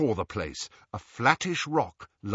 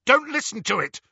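high, raised urgent warning; harsh; 13 semitones higher, 5 dB louder than usual. A narrator's reading. Harry warning Ron against the voice, harsh. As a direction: cut in harshly and loudly. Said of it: "Harry said harshly".

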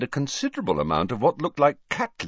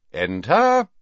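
raised, louder summons; called out; 7 semitones higher, 6 dB louder than usual. A narrator's reading. Dumbledore calls to Harry knocking at door, raised voice. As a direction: call through a door to a visitor. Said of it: "called Dumbledore".

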